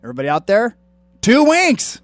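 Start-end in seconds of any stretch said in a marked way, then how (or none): 1.2-2.0 high, loud title announcement; announcer shout. Acted. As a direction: sell the album name big. Announcing the album title on air.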